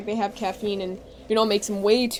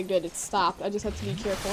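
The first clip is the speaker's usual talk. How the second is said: normal talking voice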